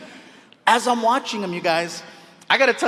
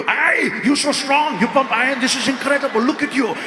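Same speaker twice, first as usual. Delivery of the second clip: put-on higher, gushing Austrian-accented greeter; Arnold Schwarzenegger; 4 semitones higher than usual. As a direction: greet each fan like a delighted Austrian action star. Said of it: "It's awesome to watch".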